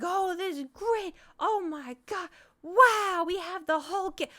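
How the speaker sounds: high, loud mock enthusiasm; imitated whooping delight; excited gushing. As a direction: gush like overexcited relatives. Imitating excited relatives greeting her.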